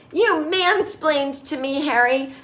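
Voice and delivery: high, drawn-out mock outrage; imitated accusation. Charlotte goes off on her husband, imitated outrage. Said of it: "she goes off on him".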